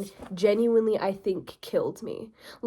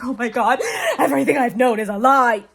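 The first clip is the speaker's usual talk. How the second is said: loud, laughing melodramatic wail; hammed-up shock, half laughing; mock horrified realization; 7 dB louder than usual. Reader mocking a gullible prince, hammed-up shock.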